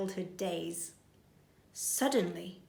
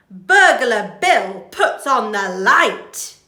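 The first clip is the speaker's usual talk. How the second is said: dramatic reveal; 14 dB louder, slower than usual. A narrator's reading.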